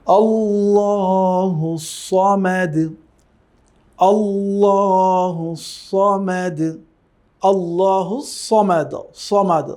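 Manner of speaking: slow melodic tilawat, Samad with a heavy সদ; Quran recitation. Shown as a demonstration. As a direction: chant আল্লাহু Samad drawn out. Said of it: "এর উচ্চারণ কি হবে".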